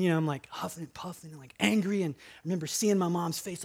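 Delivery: normal talking voice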